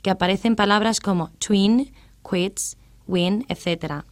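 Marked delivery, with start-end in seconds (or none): 1.4-3.5 "twin, quits, win" each with a rounded w glide; spaced model words "twin, quits, win", showing the w sound; W semivowel. Shown as a demonstration. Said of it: "sonido considerado semivowel o semiconsonant".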